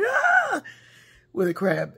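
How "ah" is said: put-on sudden high loud "Ah!" to scare the kids; playful scare cry; mock scare shout. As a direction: jump at them with a high yell. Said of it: "he would come at us and".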